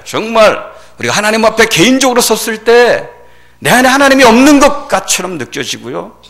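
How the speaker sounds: high, loud sermon delivery; impassioned preaching. Acted. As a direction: press each phrase out forcefully. Preaching at full force. (열정적으로 외치는 설교)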